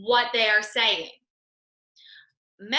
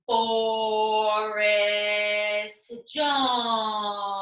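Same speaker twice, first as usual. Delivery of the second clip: slow, drawn-out name; slowly; 3 semitones lower, much slower than usual. Acted. Student Boris says his name again, slowly. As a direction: stretch each syllable of the name. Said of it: "say his name".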